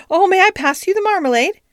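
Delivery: a flustered, high-pitched polite interruption; hurried. A narrator's reading. Duchess changing the subject over tea, hurriedly. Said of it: "said Duchess hurriedly".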